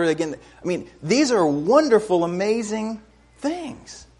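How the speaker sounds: high, lifted preacher's voice, building in wonder; rousing preaching. Real, not acted. Preacher marveling at the miracles to the congregation, rousing.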